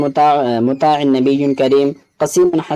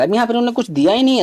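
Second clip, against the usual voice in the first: exasperated complaint; 7 semitones higher, faster than usual. Real, not acted. Complains question gives nothing.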